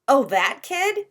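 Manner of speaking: put-on slow, wide-eyed kid surprise; surprised. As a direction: stretch it out like a startled little boy. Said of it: "his eyes opening wide".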